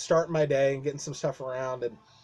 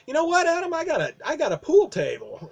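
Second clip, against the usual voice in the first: imitating the boss, pitched up; 12 semitones higher than usual. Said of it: "He's like".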